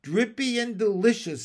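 gloating raspy frog voice; raspy bullfrog. A narrator's reading. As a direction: smack it out with a rasp. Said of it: "in his raspy voice".

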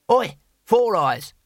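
high, slow call across the square. A narrator's reading. Cohen calling to Two Flower across the square. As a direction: call out to get his attention.

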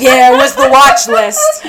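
cracking up at own line; laughing. Retold or imitated. Retelling to a friend, laughing hard.

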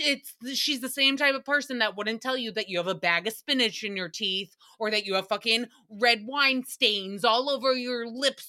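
normal talking voice

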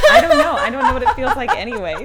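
high squealing laugh-talk. Real, not acted. Joking about hand jobs.

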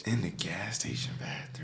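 hushed, unvoiced disbelief; appalled whisper. Real, not acted. Friend whispering in shock at the bathroom story. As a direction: whisper it in horror.

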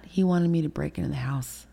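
ordinary delivery

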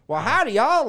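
very loud, high country howdy; big mock country accent. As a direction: holler a greeting in exaggerated country twang. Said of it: "that's how they all talked".

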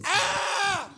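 thin mock scream of shock; imitated squeal. Man hugging the preacher, imitated high squeal. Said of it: "when he did, he goes".

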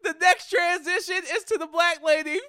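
outraged but cracking up; laughing outrage. Real, not acted.

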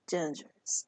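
hushed breathy whisper. Shown as a demonstration. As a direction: whisper it like a secret. Repeats the word in whisper.

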